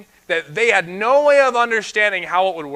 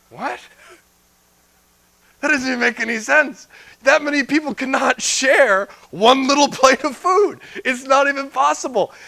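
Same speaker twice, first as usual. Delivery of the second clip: scoffing disbelief; 6 semitones higher, 3 dB louder than usual. Real, not acted. Preacher scoffing at skeptic reading.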